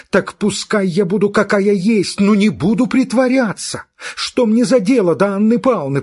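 high, loud, rising angry rant; fit of temper. Acted. Girl ranting at her friend, hot angry outburst. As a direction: lash out in a hurt temper. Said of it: "в своём припадке вспыльчивости".

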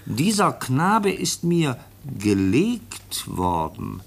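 reading a letter aloud. Acted. Officer reads the foundling's letter to the chief, slow formal reading. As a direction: read out each word slowly and carefully. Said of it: "Ich lese mal vor".

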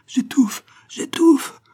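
very high strangled gasp; choking death rattle. A narrator's reading. Dying old locksmith in Malouin's arms, choking. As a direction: rasp it out while suffocating. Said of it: "râlait le vieux".

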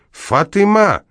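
very high, long drawn-out cry; terrible-voiced shout. A narrator's reading. Neighbour calling Fatyma to help with the horse, terrible shout. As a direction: call out the name in a dreadful howl. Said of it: "закричал Шаринов страшным голосом".

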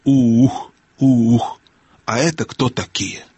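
owl's drawn-out hooting question; soft but resonant owl voice. A narrator's reading. Owl questioning two newcomers, soft hooting voice. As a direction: hoot softly, then ask the strangers. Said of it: "сказала мягким, но громким голосом".

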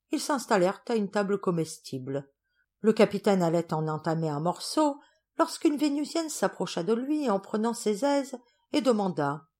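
plain reading voice

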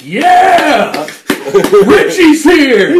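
high, loud, slow cheer; excited cheering. Pretend. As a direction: cheer like a thrilled fan. Imagined fans cheering.

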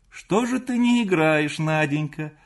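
high, loud, sing-out question; mother calling. A narrator's reading. Mother calls to daughter from room. (мать зовёт высоко и громко)

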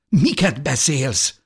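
high, slow disbelief; shocked question. A narrator's reading. Husband turns on his wife's sacrifice, shocked. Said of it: "döbbenten a feleségére".

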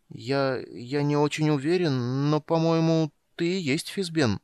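high, slow, halting voice; hesitant fear. Acted. Trembling kender telling the wizard who he is. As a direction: nervously break the news.

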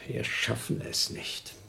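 slow hopeless whisper; whispered despair. Acted. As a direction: breathe it out, all hope gone. Despair over oxygen shortage.

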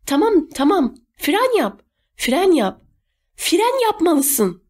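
high-pitched, panicky braking order; urgent shouting. A narrator's reading. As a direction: cry out repeatedly, stretching the last order. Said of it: "diye bağırdı". (tiz sesle, telaşla bağırarak)

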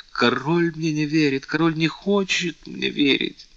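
a high, hushed cry of despair; despairing whisper. A narrator's reading. Louise, stung by the king's doubt, in hushed despair. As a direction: breathe it out, crushed and hopeless. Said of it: "в отчаянии прошептала Лавальер".

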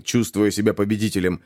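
ordinary delivery

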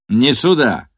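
sharp loud bark. A narrator's reading. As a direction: snap it out as a bark. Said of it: "рявкнул кожаный".

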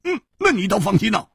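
high, fast character voice; needling, high and hurried; sceptical retort. A narrator's reading. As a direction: retort in a quick high burst.

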